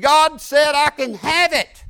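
confident wife quote; firm declaration. Retold or imitated. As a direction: insist on it, word by word. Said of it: "She said".

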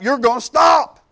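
loud, high commanding shout at the storm. Retold or imitated. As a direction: order the storm to stop with full force.

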